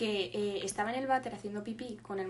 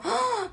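high-pitched mock gasp of horror; shocked gasp; 12 semitones higher, 3 dB louder than usual. Woman retelling her childhood night scare, shocked gasp.

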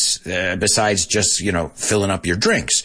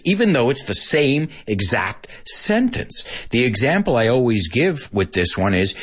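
normal talking voice

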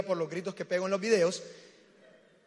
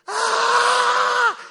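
sudden high shrieking scream, much louder; piercing mock scream of fright; imitated scream; 15 dB louder than usual. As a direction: mimic a startled man's shriek at a rat. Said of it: "ha hecho esta expresión".

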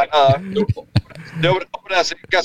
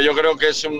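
ordinary talk, nothing marked

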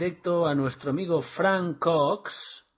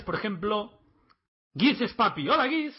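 ordinary delivery

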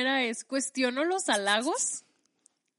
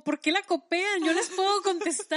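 higher and louder, hammed-up offended thought; mock indignation; 7 semitones higher, 5 dB louder than usual. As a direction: play up your own wounded pride, laughing at the end. Retells own indignant office thought.

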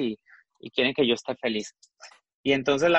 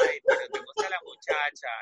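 much higher, sing-song teasing; teasing friend; faster than usual. Retold or imitated. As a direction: needle her like a cheeky friend. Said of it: "Y me dice".

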